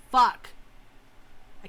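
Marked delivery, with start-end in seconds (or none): 0.1-0.5 loud frustrated curse; frustrated shout. Real, not acted. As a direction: snap it out in frustration. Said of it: "I'm gonna punch myself in the throat".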